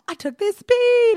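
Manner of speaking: high, loud and slow, wired on speed; amped up. Retold or imitated. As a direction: shout it high like you're jacked up. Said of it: "He's like".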